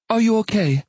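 high, brisk female character voice; woman's voice. Acted. Molly over intercom.

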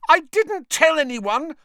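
high desperate shriek. Acted. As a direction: shriek it in panic. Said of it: "Hermione shrieked".

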